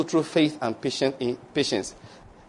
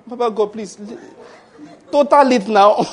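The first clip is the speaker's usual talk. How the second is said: laughing mock plea; 7 semitones higher, 6 dB louder than usual. Acted begging God to total debt.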